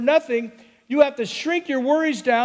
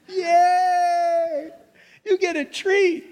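high cheering yay; excited yay; 7 semitones higher, much slower than usual. Pretend. Owner cheering puppy for going potty outside, excited yay.

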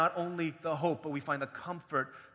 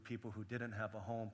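ordinary delivery

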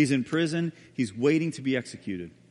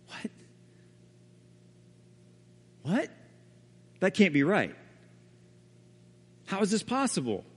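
stunned disbelief; 4 semitones higher than usual. Retold or imitated. Barabbas disbelieving release.

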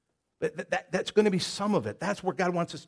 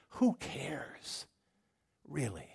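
whisper; 10 dB quieter, much slower than usual. Real, not acted. Dismissing fear of failure.

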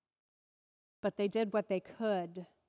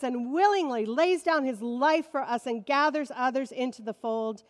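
rising, louder preaching voice; 4 semitones higher, 7 dB louder than usual. Acted. Pastor preaching to the congregation, building loud. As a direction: preach it out with full force to the congregation.

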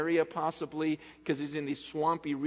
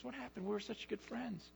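higher but much softer, wounded; hurt plea; 7 semitones higher, 12 dB quieter than usual. Retold or imitated. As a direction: ask it gently, half-broken. Paul's hurt plea to friends.

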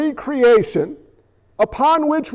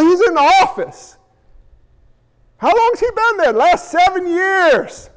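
high, lively skit voices of church folk and Moshe; mock the cheap-grace churchgoers; higher pitched comic back-and-forth; acted church scene; 6 semitones higher than usual. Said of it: "here's how we run on the other side with cheap grace".

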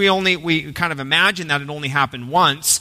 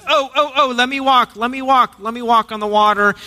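eager Peter; 5 semitones higher than usual. Retold or imitated. Preacher playing eager Peter begging to walk on water, excited. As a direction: bounce like a kid begging a turn. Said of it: "He's the one who jumped up, says".

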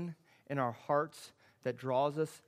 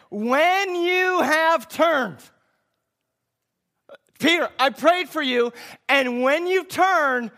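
much higher and louder, stretched words; full-force preaching; 12 semitones higher, 15 dB louder than usual. Acted. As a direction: preach it out with everything.

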